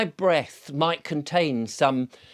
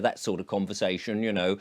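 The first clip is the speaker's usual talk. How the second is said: ordinary talk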